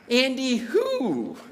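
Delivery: exaggerated high, drawn-out irritation; mock-exasperated challenge down the phone; annoyed phone voice. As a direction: stretch it out high, impatient with the caller. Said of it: "in the most annoyed voice".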